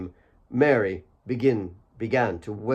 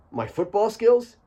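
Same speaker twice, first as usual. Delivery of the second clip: joking question; 10 semitones higher than usual. Acted. Alex joking.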